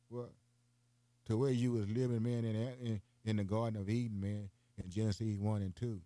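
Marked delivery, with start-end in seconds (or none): none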